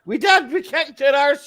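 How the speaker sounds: excited boast. Real, not acted. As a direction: crow about the victory.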